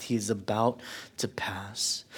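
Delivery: breathy whisper, he is about to pass; softly whispered; hushed whisper. Acted. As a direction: drop to a whisper for the dying boy. Dying son, hushed.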